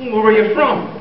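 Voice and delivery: high Irish lilt; jokey stage Irish brogue; Irish accent. Acted. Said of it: "do I detect a bit of Irish?".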